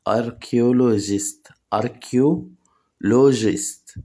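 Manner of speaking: Archaeologist drawn out very slowly, syllable by syllable; slow syllable drill. Shown as a demonstration. Teacher slowly drills Archaeologist for learners. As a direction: break Archaeologist into slow pieces. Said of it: "teacher drills the word slowly".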